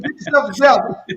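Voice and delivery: loud, high mock-dad order, full of amusement; dad laughing. Son repeating dad's order to stop crying, laughing and loud. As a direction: imitate dad's command while cracking up. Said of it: "this the part made me laugh".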